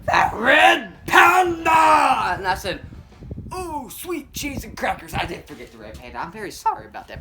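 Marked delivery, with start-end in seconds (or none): 0.0-2.4 very slow, high, loud roar; hammed-up angry bellow. Police chief to the officer, mock-furious bellow. 3.5-7.2 mock sheepish admission. Officer apologising to the chief, mock sheepish admission.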